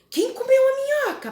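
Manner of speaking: pergunta bem aguda e alta, de professora; pergunta à criança. Shown as a demonstration. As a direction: pergunte animada como quem provoca a criança. (pergunta de professora, aguda e alta)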